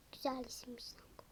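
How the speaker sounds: quick, hushed aside; fast whisper. Acted. Commenting on the new name, whispered quickly. Doll-play aside, unvoiced.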